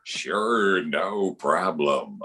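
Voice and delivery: low, slow announcer's drawl; easygoing deep broadcaster voice; Harry Kalas. Acted. Phillies announcer agreeing to a fan's request, low and unhurried. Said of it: "Harry Kalas, one of the nicest guys".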